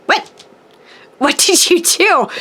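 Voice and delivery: high, laughing what did you do; surprised laughing disbelief. Retold or imitated. Retelling reaction to a gift on its way, surprised laughing. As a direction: gasp it out through a laugh, amazed. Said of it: "And I'm like".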